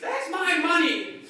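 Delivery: high, slow, put-on outraged old lady; hammed-up protest over her money; indignant shout. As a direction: squawk it like she's been robbed. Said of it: "And she said".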